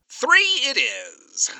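high, slow, drawn-out concession; theatrical surrender. Acted. Alistair conceding the haggling to Charlie, slow and theatrical. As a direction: give in with grand resignation.

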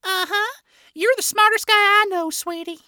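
wife praising her husband, high and fond; grinning wife. A narrator's reading. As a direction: beam at him as you say it. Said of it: "Millie grinned".

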